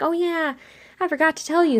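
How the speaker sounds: high, airy imitation; offhand coordinator. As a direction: voice a coordinator casually remembering. Coordinator's casual admission, voiced.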